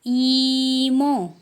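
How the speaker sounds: teacher reviewing Korean "ईमो"; Korean word. Shown as a demonstration. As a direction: model "ईमो" slowly. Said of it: "उसको बोलेंगे ईमो".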